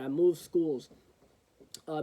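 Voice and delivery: ordinary delivery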